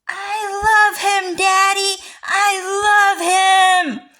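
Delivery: high, loud, slow wailing cry of I love him; whiny crying plea. Acted. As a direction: wail it like a sobbing child begging daddy. Acted quote of a whiny guy.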